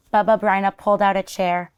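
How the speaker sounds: plain reading voice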